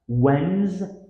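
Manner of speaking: Wednesday as WENZ, then day; syllable-by-syllable. Shown as a demonstration. Teacher showing the stress pattern, syllable by syllable. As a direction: split Wednesday in two, first half high and loud. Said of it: "Here's the stress pattern".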